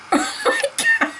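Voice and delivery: very high, slow laughing "Oh my god"; giggling in disbelief at the tiny underwear; laughing squeal. Real, not acted. Laughing at weird bottle underwear.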